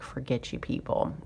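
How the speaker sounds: soft breathy mutter, almost no voice; muttered brush-off. Retold or imitated. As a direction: grumble it under your breath. Fed up, leaving the dealer.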